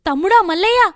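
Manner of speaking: pleading call. Acted. Sister pleading with brother.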